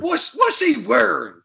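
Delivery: high, slow put-on old man; mock old coach, high and slow. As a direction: play the grumpy old coach asking. Coach voice continues in skit.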